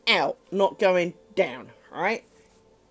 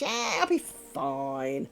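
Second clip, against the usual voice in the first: very slow, lower, softer understatement; British stiff upper lip; 5 semitones lower, 5 dB quieter, much slower than usual. Retold or imitated. Shrugging off the flood risk, slow British understatement. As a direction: drawl it out like nothing is wrong. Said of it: "very British".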